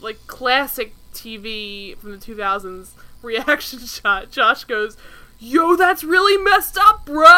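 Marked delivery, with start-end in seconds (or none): 5.4-7.2 high, loud, slow hammed-up outrage; mock freak-out. Podcaster imitating Josh confronting Brian, mock freak-out. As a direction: drag out the indignation, loud and high. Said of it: "he freaks out at Brian".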